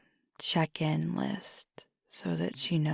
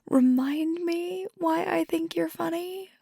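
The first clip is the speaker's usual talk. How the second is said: laughing tease; 13 semitones higher, 4 dB louder than usual. Acted. Teasing partner after bad pun.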